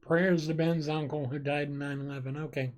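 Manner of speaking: usual speaking voice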